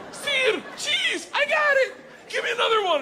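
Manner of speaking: very high, loud, laughing eagerness; eager shout. Retold or imitated. Answering Igor's vocabulary quiz, a very high eager shout. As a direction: yell the answer like a proud pupil.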